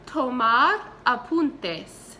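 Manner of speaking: Tomar apuntes spoken slowly and clearly; slow model phrase. Shown as a demonstration. Teacher modeling a phrase for students to repeat. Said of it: "So, repite, por favor".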